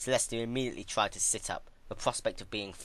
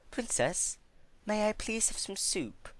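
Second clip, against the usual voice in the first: higher, meek careful request; polite plea; 13 semitones higher than usual. Acted. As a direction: ask like a well-behaved lady. Celestia asking politely.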